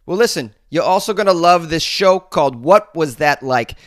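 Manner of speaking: a thick Boston accent, read ad-style; podcast ad read in a Boston accent. Real, not acted. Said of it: "I know you like my Boston accent".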